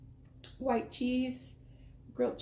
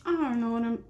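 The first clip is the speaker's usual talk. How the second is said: ordinary talk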